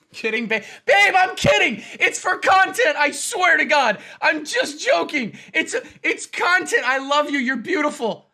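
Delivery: high, frantic comic backpedalling; mock panicked pleading. Husband telling wife it's just content, mock panic.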